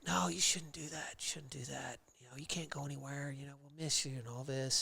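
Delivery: hushed, half-whispered imitation of worried friends; mock pleading. Buddies urging their friend not to move, mock pleading. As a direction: softly mimic buddies talking you out of it.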